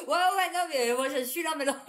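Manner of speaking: cracking up at herself; giggling through it; laughing. Real, not acted. Laughing at her own paranoia.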